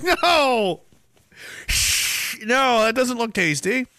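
put-on high, slow childlike refusal; child refusing. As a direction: recoil like a grossed-out kid.

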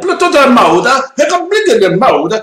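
high, loud mock Chinese accent; imitating a thick Chinese accent. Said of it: "speaks with such a thick accent".

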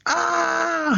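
high, sudden mock yell; retold yell. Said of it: "Thurman just goes".

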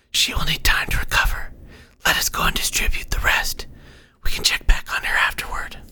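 whispered, breathy orders; whispering instructor. Acted. Unseen guide telling the cleric how to make cures, whispering. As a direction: give instructions in an airy whisper.

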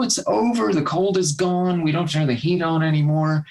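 high at first, easing down; mock premature joy. Voicing locals who think the cold is gone, mildly mock-joyful. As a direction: lightly play the giddy believer.

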